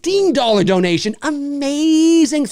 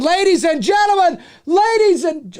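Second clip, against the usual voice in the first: high, hyped call to the audience; excited announcing; 6 semitones higher than usual. Real, not acted. Host starting a big announcement to viewers, excited announcing.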